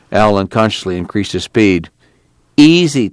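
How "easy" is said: sharp, higher-pitched warning; urgent heads-up. A narrator's reading. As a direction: caution the driver with one raised word. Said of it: "Tom warned him".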